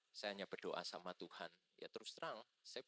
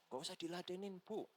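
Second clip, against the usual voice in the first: higher-pitched imitated wife; wife's advice; 8 semitones higher than usual. Pastor's wife tells the mother not to indulge her, higher-pitched. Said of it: "istri saya bilang".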